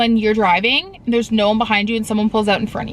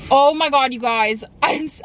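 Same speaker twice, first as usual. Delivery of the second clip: ordinary talk, nothing marked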